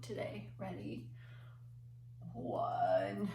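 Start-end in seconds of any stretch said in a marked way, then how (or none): 2.3-3.3 weak hoarse sick voice. Shown as a demonstration. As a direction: count like you have a cold. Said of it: "use our sick voice to count".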